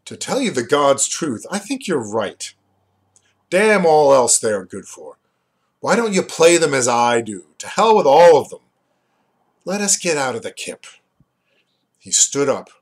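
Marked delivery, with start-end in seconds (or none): 0.0-10.9 louder, blunt and fed up; swinging into a loud, frank outburst; suddenly changed tone. A narrator's reading. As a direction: suddenly drop the act and speak bluntly. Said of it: "In a suddenly changed tone".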